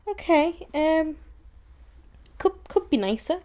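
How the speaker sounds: stunned. Retold or imitated. Retelling her stunned reaction.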